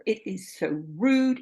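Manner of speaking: ordinary talk, nothing marked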